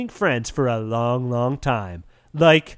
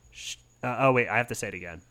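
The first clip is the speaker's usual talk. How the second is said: normal talking voice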